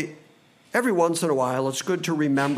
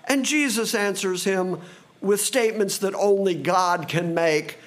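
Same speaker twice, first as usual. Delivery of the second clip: usual speaking voice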